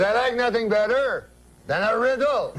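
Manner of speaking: put-on high-pitched French-Canadian accent; eager uncle with a French-Canadian accent. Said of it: "That's how he talks".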